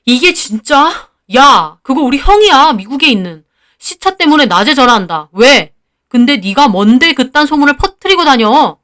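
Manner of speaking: acted yell, heated but not full force; angry yelling. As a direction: snap back at a false accusation. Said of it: "저는 소리를 질렀습니다".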